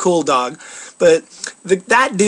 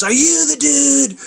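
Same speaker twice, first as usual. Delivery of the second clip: imitating a yell; 6 semitones higher, slower than usual. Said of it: "he yells at me and he goes".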